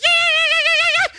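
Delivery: very high, loud whooping yeahs; imitating ecstatic cheering. Preacher voicing villagers celebrating the resurrection, wild high cheer. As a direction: cheer like a crowd that just saw the risen Jesus. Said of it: "they went absolute nuts".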